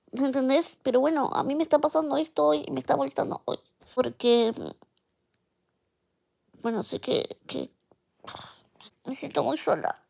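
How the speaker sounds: tearful, quiet and shaky; crying softly while talking; snotty crying. Real, not acted. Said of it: "Llorando, check".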